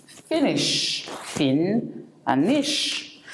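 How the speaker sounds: "Finnish" broken into Finn and nish; split into syllables. Shown as a demonstration. Teacher breaking the word apart for learners, syllable by syllable. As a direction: say "Finnish" in two parts, Finn then nish. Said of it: "You see, it has double end".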